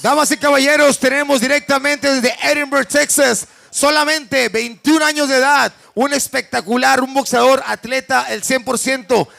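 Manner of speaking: loud Spanish fight-night intro; lucha libre announcer. Real, not acted. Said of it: "lucha libre announcer".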